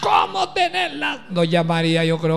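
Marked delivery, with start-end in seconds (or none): 0.0-1.3 exasperated mock yell. Doña María reacts to her lazy daughter-in-law, mock outraged yell. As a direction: imitate a mother-in-law at wit's end. Mother-in-law's exasperated reply.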